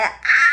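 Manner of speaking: loud, gushing squeal of self-adoration; delighted squeal. Retold or imitated. Admiring myself during skincare, loud delighted squeal. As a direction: squeal out loud at how pretty you are. Squeals over own reflection.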